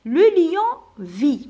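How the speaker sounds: slow, loud model answer with a rise on ليون; teacher models the answer. Shown as a demonstration. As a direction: read the answer out slowly and loudly, ليون lifted. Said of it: "في الجواب نبدا بالسوجي".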